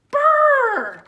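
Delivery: high, loud shivery brr; shivering brr. Acted. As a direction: shiver from the cold. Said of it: "shiver and quiver out in the cold".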